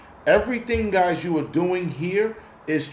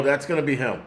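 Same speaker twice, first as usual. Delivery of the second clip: usual speaking voice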